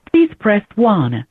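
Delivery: ordinary delivery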